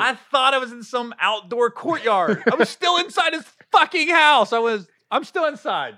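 amazed and laughing; excited disbelief. Real, not acted. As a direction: build up to a near shout. Retelling amazement, louder.

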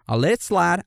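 ordinary talk

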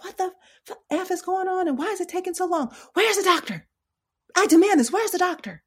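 high, loud demanding patient yelling; angry patient yelling. Retold or imitated. Nurse imitating a patient yelling for the doctor, angry. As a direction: play the irate patient shouting for the doctor. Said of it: "that patient yelling and screaming".

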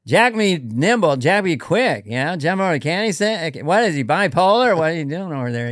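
folksy, meandering comic with lilting emphasis; rambling deadpan, swooping on key words; Norm. Acted. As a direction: puzzle aloud, searching for the phrase. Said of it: "David and I do Norm".

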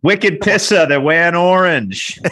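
put-on loud, high Boston accent. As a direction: ham up a thick Boston accent. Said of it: "you should do it in a good Boston accent for us".

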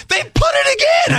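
high shouted mock wife; imitating angry wife. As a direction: screech it like his upset wife. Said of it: "She said".

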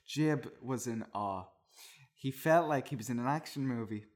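ordinary talk, nothing marked

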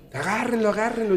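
high urgent cry; mock urgent call. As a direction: call out to hold him down.

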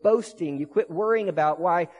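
high, loud, driving sermon climax; fired-up pulpit preaching; preaching at full force. Acted. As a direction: preach it out to the whole room.

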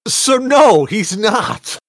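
high, loud protest; emphatic denial. Real, not acted. Host answering friends' teasing about the kid, loud high protest. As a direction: shut the teasing down loudly.

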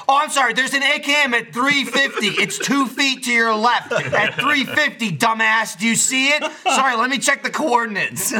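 high, exaggerated mock apology; hamming it up. Mocking Andy who cannot find the gun, hammed-up sarcastic voice. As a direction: overplay helpful directions with sarcasm. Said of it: "he was hamming it up like".

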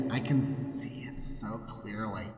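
low, softening to a hush; tender sympathy. Retold or imitated. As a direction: gently sink into play-acted compassion. God gently reads Abram's broken heart.

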